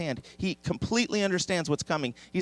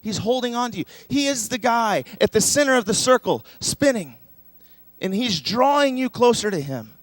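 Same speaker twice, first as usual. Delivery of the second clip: higher and louder, preaching with fervor; full-force preaching; 7 semitones higher, 6 dB louder than usual. Acted. Pastor assuring the congregation Jesus holds them, fervent and loud. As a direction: lift the room with rising conviction.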